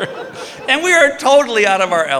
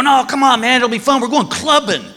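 eager friends; 8 semitones higher than usual. Retold or imitated. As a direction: egg him on like a pushy buddy. Friends pushing him to go clubbing.

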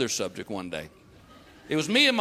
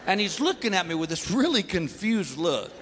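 usual speaking voice

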